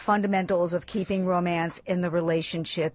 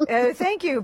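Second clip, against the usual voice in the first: ordinary delivery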